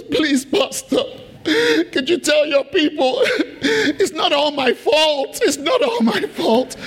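high, slow, hammed-up sobbing plea; mock-weep through the words, pitch way up; crying Satan. Satan begging the pastor to tell his people, hammed-up sobbing. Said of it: "Satan, you're crying".

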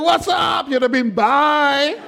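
high, drawn-out comic cry; comic panic. Acted. Onlooker fleeing the risen Lazarus, high comic panic. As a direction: call it out high, as if bolting.

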